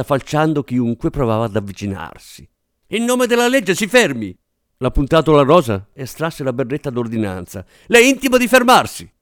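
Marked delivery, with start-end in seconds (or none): 2.9-4.4 police command. Acted. Policeman orders suspect to stop. 7.9-9.1 police command. Acted. Policeman orders suspect to stop.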